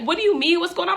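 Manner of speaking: high-pitched retold alarm; alarmed friend. Friend reacting to driver's panicked call, alarmed.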